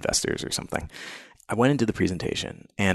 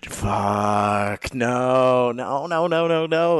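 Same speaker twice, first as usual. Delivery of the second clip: building no, no, no, climbing higher; rising panic; 5 semitones higher, much slower than usual. Retold or imitated. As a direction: spiral into panic. Panicking in bed at the news.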